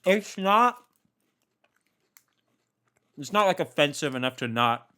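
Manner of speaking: talking through a mouthful; chewing while speaking; mouth full. Real, not acted. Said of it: "talk with my mouth full".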